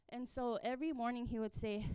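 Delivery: normal talking voice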